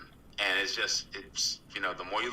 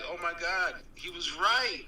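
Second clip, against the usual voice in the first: mock stunned realization; 7 semitones higher than usual. Imagined doubter finally admits it.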